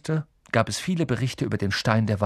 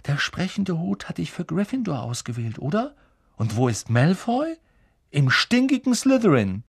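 Harry encouraging; 7 semitones higher than usual. A narrator's reading. Harry cheering Neville up.

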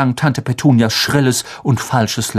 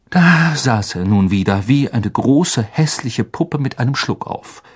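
usual speaking voice

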